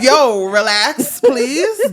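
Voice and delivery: loud, slow, drawn-out begging; exasperated plea. Acted. Mother begs her worked-up daughter to settle, exasperated plea. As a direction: climb high on a mock-pleading "please".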